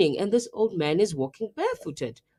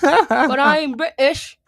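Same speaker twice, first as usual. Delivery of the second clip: slow, loud, higher British put-on; mock British accent; 6 semitones higher, 7 dB louder than usual. Host refusing to stop the joke, in a mock British accent. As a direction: protest it loudly in a fake British accent. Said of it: "killing it with this accent".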